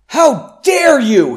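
high, loud, slow furious scream; villain screaming at his taunting rival. A narrator's reading. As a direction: scream it out in outrage. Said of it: "he screamed".

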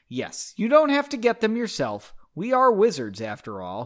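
ordinary delivery

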